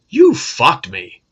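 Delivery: ordinary talk, nothing marked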